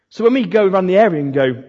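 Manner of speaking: usual speaking voice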